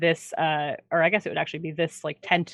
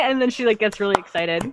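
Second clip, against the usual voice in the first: ordinary delivery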